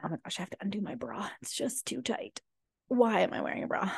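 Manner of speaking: quick hushed whisper; whispered aside. Real, not acted. Aside about undoing her bra.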